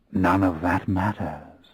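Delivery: a touch of a refined Englishman, unhurried; soft cultured English voice. A narrator's reading. David reassuring Lestat, soft cultured English voice. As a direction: quietly voice the composed English gentleman. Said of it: "he said in that soft, cultured English voice".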